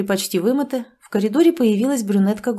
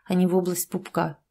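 plain reading voice

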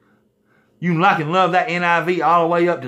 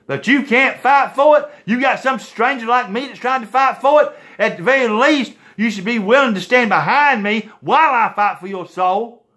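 impassioned preaching; 6 semitones higher than usual. Real, not acted.

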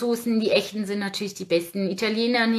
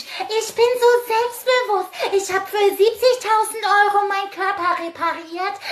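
much higher, louder mocking girly voice; 10 semitones higher, 5 dB louder than usual. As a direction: parody her smug self-confidence. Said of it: "[name] steht da und erzählt euch".

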